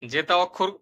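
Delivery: ordinary talk, nothing marked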